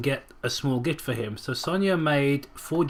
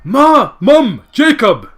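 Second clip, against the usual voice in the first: much higher and louder shouts; yelling; 8 semitones higher, 12 dB louder, much slower than usual. Acted. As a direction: yell the names at them. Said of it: "she yelled".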